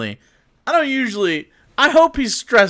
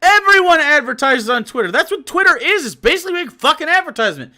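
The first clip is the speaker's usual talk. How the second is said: indignant rant; 10 semitones higher, 4 dB louder than usual. Real, not acted. Heated ranting.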